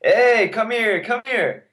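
high drawn-out call across the street; slow, high street call "hey, come here". Retold or imitated. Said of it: "calling you over".

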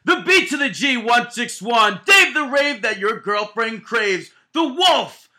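booming ringmaster announcement; big top ringmaster intro, loud and high. Acted. Announcer-style show intro.

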